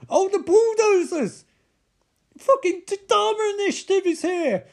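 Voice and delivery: high, loud exclamation; animated shout of surprise; excited outcry. Real, not acted. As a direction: call out the sudden twist in alarm. Exclaiming at the film's twist.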